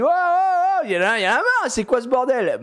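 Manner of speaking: imitated exasperated scolding; fed-up dad intervening. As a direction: retell a parent breaking up a squabble. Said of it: "Et je lui dis".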